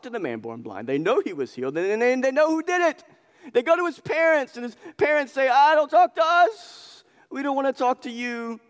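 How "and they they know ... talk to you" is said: much higher put-on voice of scared parents; hammed-up nervous parents backing away; mock fearful parents. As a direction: squeak out the parents' dodge. Parents voiced in retold scene.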